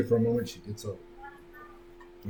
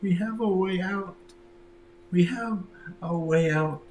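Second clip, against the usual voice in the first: put-on higher, pleading girl's voice; pleading daughter; 8 semitones higher than usual. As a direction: beg your mother to understand.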